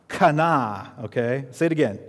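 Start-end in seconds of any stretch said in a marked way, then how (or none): none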